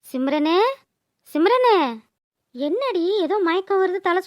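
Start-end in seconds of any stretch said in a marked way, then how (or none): 1.3-1.8 higher second call of the name; insistent call. Acted. Calling her louder second time. (உரக்கக் கூப்பிடும் குரல்)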